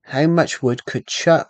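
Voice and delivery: slow, deliberate reading of the twister; slow careful twister reading. Real, not acted. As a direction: read it out slowly, word by word. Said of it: "I've gotta read it properly".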